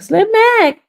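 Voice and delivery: very high, loud shout of the name; excited greeting. Real, not acted. Streamer spotting a friend in chat, excited greeting. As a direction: squeal the name out in delight. Spots a known viewer, calls out.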